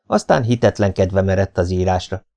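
usual speaking voice

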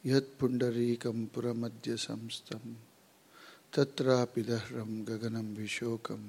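soft, even chant with pauses between phrases; hushed, measured prayer recitation; Sanskrit prayer. Real, not acted. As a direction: recite the invocation quietly and evenly. Opening Sanskrit prayer before the lecture.

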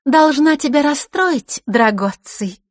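put-on high, loud, slow girlish taunt; jeering. A narrator's reading. Girl disappoints her rival, jeering. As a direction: announce it with relish, mockingly. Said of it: "с издёвкой заявила девчонка".

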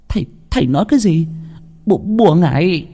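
a wife who can't believe it; shocked wife. A narrator's reading. Wife to the healer, stammering shock.

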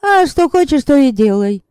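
high, loud spiteful retort. Acted. Yaska spitefully brushing off the vampire, high and loud. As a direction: say it with petty revenge. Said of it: "мстительно произнесла Яська".

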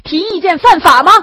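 high, loud cry of protest; defiant, indignant shout; repeated shouting. A narrator's reading. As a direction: keep yelling it while being dragged off. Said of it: "他不断地喊".

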